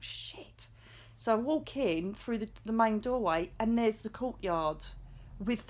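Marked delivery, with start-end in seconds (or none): 0.0-0.7 breathy whispered swear, no voice at all; whispered curse. Retold or imitated. Stunned in the church, she whispers a curse. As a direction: mouth it under her breath in shock. Realising the monk's church is real.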